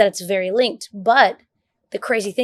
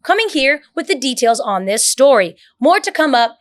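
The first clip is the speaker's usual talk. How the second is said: higher, louder polished news anchor read; newscaster read; 4 semitones higher, 4 dB louder than usual. Shown as a demonstration. Showing friends how a newscaster talks, news anchor read. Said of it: "And they said newscaster".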